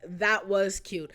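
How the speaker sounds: slow, drawn-out repeat; slow fond repeat of That was cute; slow savoring. Real, not acted. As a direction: savor each word. Repeats the phrase three times.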